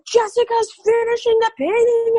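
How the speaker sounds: mock MC calling it out; imitating the MC. Quoting the MC announcing her to the crowd. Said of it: "we had an MC who was like".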